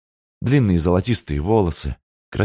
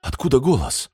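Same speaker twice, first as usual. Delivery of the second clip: frightened; 6 semitones higher than usual. A narrator's reading. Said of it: "испуганно спросила Маша".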